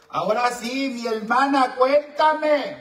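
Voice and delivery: high, loud rising invitation; calling out. Real, not acted. Host inviting the caller to tell her story, calling out. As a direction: belt it out like a show host.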